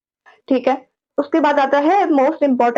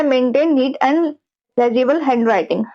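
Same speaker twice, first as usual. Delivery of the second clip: each word spaced out slowly like a heading; deliberate slow heading read; slow dictation; much slower than usual. Shown as a demonstration. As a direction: dictate it slowly so students can write. Teacher dictates a tip heading.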